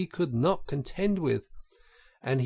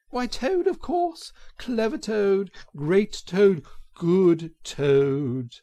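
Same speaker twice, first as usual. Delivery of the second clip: high, gleeful self-praise, loud at the end; self-admiring Toad; 6 semitones higher, 5 dB louder, slower than usual. A narrator's reading. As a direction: cheer yourself like your own biggest fan. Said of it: "in the ecstasies of self-admiration".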